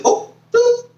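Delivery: high, squeaky mock clerk voice; cheeky high put-on voice; squeaky mock official. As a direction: imitate the agency grabbing the check, way up high. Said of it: "they said".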